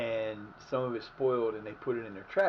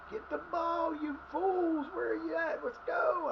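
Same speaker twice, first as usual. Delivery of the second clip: much higher, urgent yelling; high-pitched yelling; slower than usual. Real, not acted. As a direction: shriek at the players to move. Urging players on.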